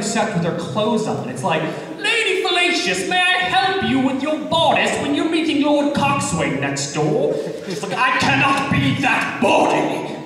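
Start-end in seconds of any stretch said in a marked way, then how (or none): 2.0-7.3 high, theatrical costume-drama voice; hammed-up period-drama lady's caller; Masterpiece Theater parody. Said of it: "Masterpiece Theater". 8.0-10.2 high, slow, theatrical declaiming; hammed-up costume-drama exclamation; Masterpiece Theater parody. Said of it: "Masterpiece Theater".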